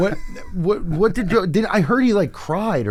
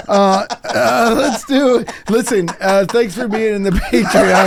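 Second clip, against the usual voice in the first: laughing; 8 semitones higher, 6 dB louder, slower than usual. Real, not acted. Laughing into Patreon read.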